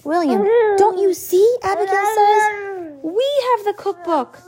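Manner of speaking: put-on high, loud eager child voice; a girl bursting with the idea; excited realization. A narrator's reading.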